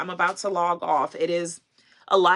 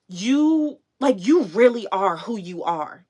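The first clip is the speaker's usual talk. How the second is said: emotional fan; 3 semitones higher, 7 dB louder, slower than usual. Retold or imitated. Retelling fan's emotional words.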